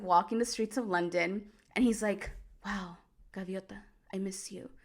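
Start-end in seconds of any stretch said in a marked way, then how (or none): 2.6-4.7 breathy whisper, lovesick hero; longing whisper. Retold or imitated. Rodrigo missing Gaviota in London, whispered longing. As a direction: whisper it like he senses her near.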